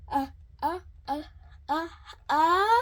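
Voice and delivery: rising gasps before a sneeze; acted pre-sneeze gasps, mounting; sneeze building. A narrator's reading. Elephant about to sneeze.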